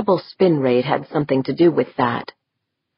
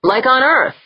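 excited holler; 9 semitones higher, 4 dB louder than usual. A narrator's reading. Yeager keeps hollering.

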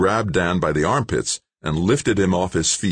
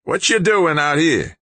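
put-on loud, high old man's demand; sharp question; 13 semitones higher, 5 dB louder than usual. A narrator's reading. Old man demanding the stranger's business, sharp and loud. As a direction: fire it out sharply, pitched way up. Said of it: "the old man asked sharply".

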